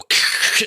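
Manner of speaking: imitated crackle of spraying sparks; short voiceless burst of sparks; spark noise. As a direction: make a quick harsh spark sound. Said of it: "you see some sparks go".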